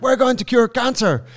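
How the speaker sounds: high, loud, beaming greeting; imitating an overjoyed Wim Hof; excited Wim Hof impression. Friend retells meeting Wim Hof, high loud beaming voice. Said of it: "He's like".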